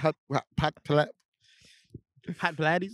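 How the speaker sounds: stuttered attempts at reading "Pilates"; baffled reader sounding it out; mock confusion. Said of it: "being just so confused".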